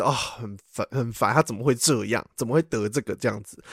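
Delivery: low, soft imitated sigh; hushed mock exasperation; sighing, annoyed. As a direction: grumble it quietly, like a weary mom. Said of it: "我妈应该就只是一个叹气".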